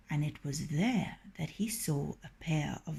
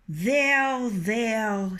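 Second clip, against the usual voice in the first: soothing rooster; 4 semitones higher, 5 dB louder than usual. Acted. Husband soothing frightened hen.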